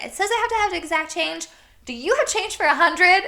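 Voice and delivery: high, fast, loud acted pleading; tearful frantic plea. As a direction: plead as if close to tears, hammed-up. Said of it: "tears in my eyes".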